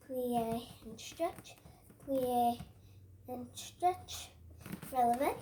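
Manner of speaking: drawn-out ballet cue; slow drawn-out cue. Shown as a demonstration. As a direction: stretch each word with the move. Teacher cueing moves slowly.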